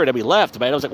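normal talking voice

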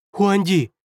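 high, startled protest; alarmed cry. A narrator's reading. First counselor startled as emperor bends down, crying out. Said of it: "подскочил первый советник".

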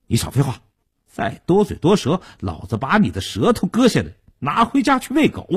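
put-on menacing young thug, pitch leaping high; vicious threat. A narrator's reading. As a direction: threaten the captive into silence. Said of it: "恶狠狠地威胁道". (恶狠狠地威胁)

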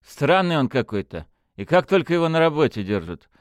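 indignant. A narrator's reading. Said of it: "возмутился Бибиков".

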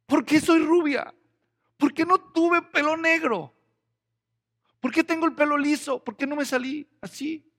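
put-on high, loud, petulant complaining girl; whiny girl. Girl at the mirror complaining about her hair, high whiny voice.

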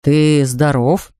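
a touch of alarm; anxious Mr Brown. Acted. Father asks the bear if he is ill, mildly anxious. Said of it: "тревожно осведомился мистер Браун".

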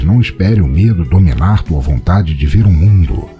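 usual speaking voice

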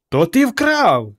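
high, slow, drawn-out accusing cry; horrified wail of accusation; mother yelling. A narrator's reading. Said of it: "зарепетувала мати".

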